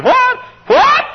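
mock disbelief repeated high; rising incredulous what. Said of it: "I said".

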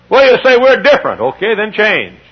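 high, loud preaching voice; mock the excuse, then answer. Preacher to congregation, high loud preaching.

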